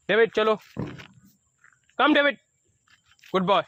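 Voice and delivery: short clear commands to the dog; calling the dog. Shown as a demonstration. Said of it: "कम बोलते ही".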